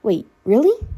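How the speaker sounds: hope breaking through; filtered clone girl, suddenly hopeful. Acted. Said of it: "There was hope in the girl's voice".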